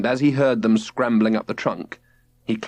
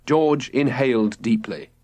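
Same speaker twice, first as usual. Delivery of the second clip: plain reading voice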